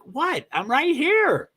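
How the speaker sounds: high-pitched baffled pup; comic high pet voice; puzzled dog voice. Acted. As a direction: squeak it like a confused dog. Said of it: "Is that the right voice inflection?".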